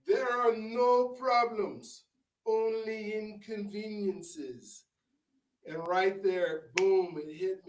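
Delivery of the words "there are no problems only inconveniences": slow, high, savouring every word; wise concierge. Retold or imitated. Concierge calming the furious guest, slow and high. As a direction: deliver it like island wisdom, taking your time. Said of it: "He said".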